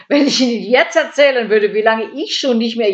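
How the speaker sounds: gushing. A narrator's reading. Käthe launches into her woes to the burglar, gushing. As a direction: launch into it at full volume.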